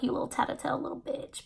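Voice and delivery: fast, spiteful whisper; glaring at the tattletale, whispered insult; insult under the breath. Retold or imitated. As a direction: hiss the insult where no one can hear. Insulting the attendant who told.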